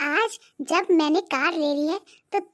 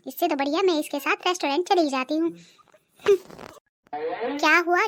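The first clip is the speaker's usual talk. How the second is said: ordinary delivery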